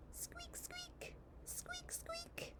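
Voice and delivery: tiny, very high whispered squeaks; mouse squeak. Acted. Reader plays the mouse for a child, tiny high squeaks. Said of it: "And the mouse says".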